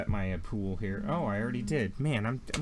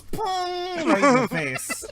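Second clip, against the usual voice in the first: very high, loud cartoon bonk; clang; 8 dB louder, much slower than usual. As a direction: shout the sound of the wrench hitting. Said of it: "he just goes".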